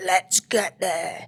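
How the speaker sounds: slow, strained voice for an eager dog; lightly voiced determined dog; dog on a mission. Owner voicing the dog heading to potty spot, determined. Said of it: "he's just like".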